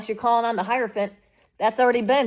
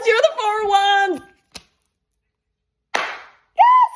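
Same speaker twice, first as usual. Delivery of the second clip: much higher, louder, slow triumphant cry; excited reveal; 12 semitones higher, 7 dB louder, much slower than usual. Real, not acted. Reader revealing the card to viewers, triumphant cry. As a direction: celebrate the card.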